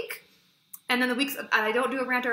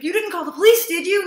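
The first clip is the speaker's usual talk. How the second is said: higher and louder, rising in panic; alarmed question; 7 semitones higher, 10 dB louder than usual. Retold or imitated. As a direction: sound caught out and worried. Brother alarmed the police were called.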